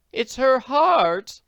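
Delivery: slow, high, loud alarm; loud high alarmed cry. A narrator's reading. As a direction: call out in alarm slowly. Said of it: "Missus Potter cried".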